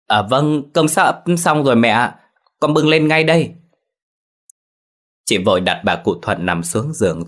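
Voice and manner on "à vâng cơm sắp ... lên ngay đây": high, louder call from another room; hurried call out. A narrator's reading. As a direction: call back quickly to the mother-in-law, high and loud. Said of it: "vội đáp vọng ra". (vội gọi vọng ra, cao và to)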